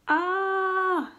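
long, high, loud storybook scream; fisherman's scream. A narrator's reading. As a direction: scream one drawn-out "ah" in mock terror. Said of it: "screamed the fisherman".

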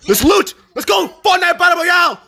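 loud, high battle cry; hyped rallying shout. Pretend.

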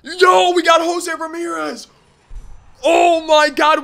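high, loud burst of joy at the pull; thrilled yell over a lucky card; excited yell. Real, not acted. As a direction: shout it like you just hit the jackpot. Pulled wanted player card.

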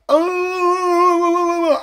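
long, high, held "uh" of disbelief; stunned drawn-out uh. Retold or imitated. Watching the little homie back down, stunned. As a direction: hold a high moan, stunned.

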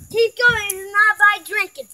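usual speaking voice

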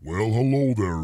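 normal talking voice

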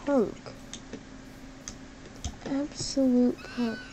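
ordinary talk, nothing marked

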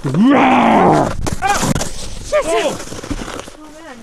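a playful jump-scare yell; mock scare shout. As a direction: bark it out to spook someone. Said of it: "let's scare Grace".

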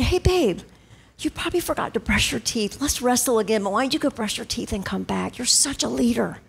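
soft, low, tender coaxing; hushed, encouraging parent; gentle correction. Retold or imitated. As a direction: correct a loved one kindly and quietly. Voices the gentle alternative correction.

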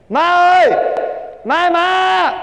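high, drawn-out shout to his mother; urgent shout. Acted. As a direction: yell for mom across the house. (gọi má thật lớn, kéo dài)

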